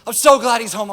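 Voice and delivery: high, loud shouts of joy, easing off; overjoyed brother. Acted. Brother hears his sibling is home, overjoyed shouting. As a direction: cheer loudly, then settle into gushing relief. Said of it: "To which the brother said".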